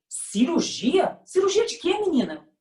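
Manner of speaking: rising in disbelief, cirurgia stretched out; shocked mother asks again, high; astonished mother. Retold or imitated. Mother hears of the surgery, stunned and high. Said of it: "Ela me olhou espantada e falou".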